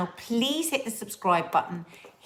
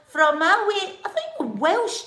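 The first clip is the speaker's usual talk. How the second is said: high, loud Scottish voice; Scottish accent; 12 semitones higher, 4 dB louder than usual. Pretend. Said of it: "in a Scottish accent".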